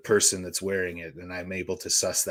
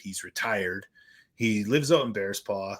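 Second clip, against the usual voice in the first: ordinary delivery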